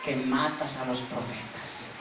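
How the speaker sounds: slow, subdued grieving call; tearful lament. Acted. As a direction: mourn over a beloved city, drawn out. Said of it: "comenzó a llorar y dijo".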